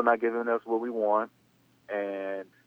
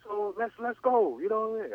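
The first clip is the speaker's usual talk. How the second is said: much higher, fired-up dad voice; a high, brash father brushing off the money; father's voice; 11 semitones higher than usual. Retold or imitated. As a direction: play the dad arguing to go for the NFL. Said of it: "My father was like".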